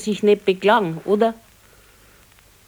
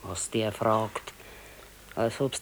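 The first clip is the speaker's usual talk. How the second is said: slow, much softer muttering to herself; muttered aside; 7 semitones lower, 11 dB quieter than usual. Acted. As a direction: roll your eyes and grumble it under your breath. Exasperated aside about husband.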